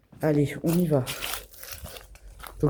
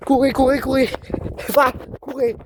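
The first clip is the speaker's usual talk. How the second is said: panicked shouting; 7 semitones higher, 4 dB louder than usual. Real, not acted. Running away from chaser.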